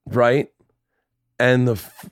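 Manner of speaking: ordinary talk, nothing marked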